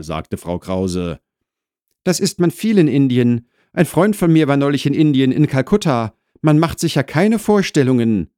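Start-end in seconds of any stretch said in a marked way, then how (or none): 2.0-8.3 put-on higher, louder polite young neighbour; Wolfgang chatting. Newcomer tells wife about friend's India trip, chatty and raised. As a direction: make earnest small talk, lifted above narration.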